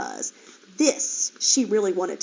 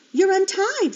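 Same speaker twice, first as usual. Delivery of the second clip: surprised; 10 semitones higher, 5 dB louder than usual. Acted. Piglet surprised at mother.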